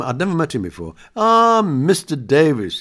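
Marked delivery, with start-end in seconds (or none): 1.2-2.8 slow, drawn-out, louder and higher greeting; lightly voiced Alan Rickman greeting. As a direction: savour the name like a grand actor. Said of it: "he said".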